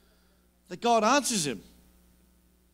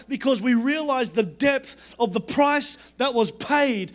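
higher, driving declaration of faith; impassioned preaching; 5 semitones higher than usual. Real, not acted. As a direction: build insistent repetitions with full conviction.

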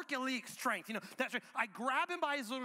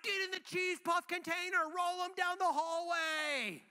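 rowdy party command; 8 semitones higher than usual. Acted. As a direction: egg the kids on wildly. Egging kids on at the party.

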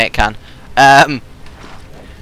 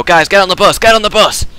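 urgent shout; 7 semitones higher, 3 dB louder than usual. Real, not acted. Urging friends onto bus.

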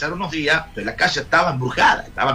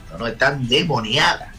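normal talking voice

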